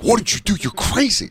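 high mock scold; scolding the dog. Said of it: "I'm like".

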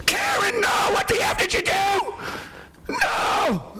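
high frantic shouting at Karen; panicked yelling at his wife over lost money; Henry Hill screaming at Karen; Ray Liotta as Henry Hill. Retold or imitated. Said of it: "I wanna nail the words right".

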